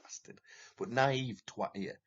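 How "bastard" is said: muttered insult. Retold or imitated. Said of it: "I was like".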